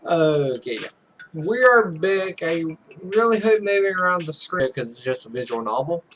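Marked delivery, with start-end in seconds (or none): none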